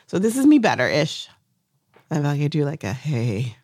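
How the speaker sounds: ordinary talk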